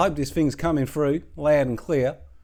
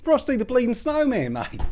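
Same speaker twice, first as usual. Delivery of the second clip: mate insisting; 9 semitones higher than usual. Retold or imitated. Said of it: "He's like".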